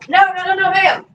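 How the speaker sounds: high, urgent no-no-no to the dog; scolding the dog. Real, not acted. Owner scolding her dog for chewing glass, high and urgent. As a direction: stop her chewing that glass right now.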